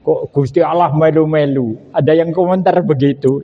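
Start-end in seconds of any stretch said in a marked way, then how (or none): none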